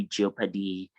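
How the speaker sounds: jeopardy as JEE-oh-pa-dee; an extra O syllable in jeopardy; wrong spelling pronunciation. Shown as a demonstration. Teacher begging students not to, jeopardy as JEE-oh-pa-dee. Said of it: "Please don't say".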